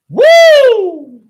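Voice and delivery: shrill excited whoop yelled out; sudden loud woo of delight; loud high whoop. Real, not acted. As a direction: let out a big joyful whoop. Excited whoop mid rant.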